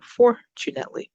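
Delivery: fortunately with FOR lifted high; teacher's demo of FOR-tunately; stress on first syllable. Shown as a demonstration. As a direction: punch FOR, then drop through fortunately. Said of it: "we go up".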